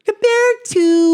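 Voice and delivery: mock voice. Mock voice continues.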